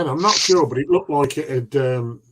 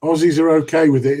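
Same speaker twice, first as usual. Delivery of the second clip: normal talking voice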